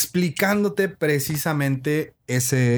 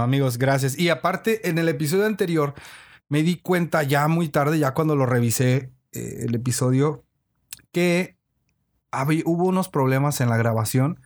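ordinary delivery

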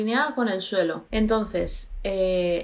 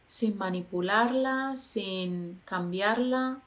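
guided meditation; 4 dB quieter than usual. Acted. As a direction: lead them gently into calm.

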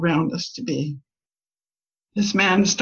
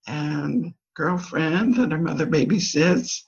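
normal talking voice

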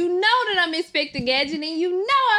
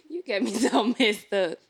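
ordinary talk

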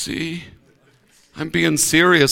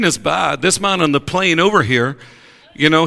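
normal talking voice

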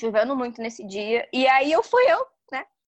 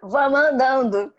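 drawn out and louder, half laughing; slow, louder, through laughter; 7 dB louder, slower than usual. Retold or imitated. Repeating her order to her brother, slow and laughing. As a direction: stretch it out slowly while laughing. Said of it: "foi mesmo?".

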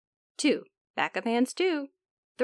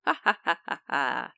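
mocking laugh; 9 semitones lower than usual. Germ mocks water.

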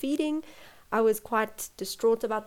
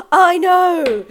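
loud, high, drawn-out exasperation; retold frustration; 8 semitones higher, 12 dB louder than usual. Mother who knew baby was fine, retold exasperated cry. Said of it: "It was so frustrating".

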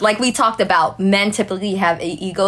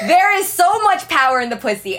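emphatic laughing declaration; 7 semitones higher than usual. Real, not acted. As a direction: announce it proudly through a laugh. Laughing bold claim.

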